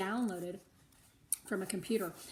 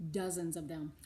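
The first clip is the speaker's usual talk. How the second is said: plain reading voice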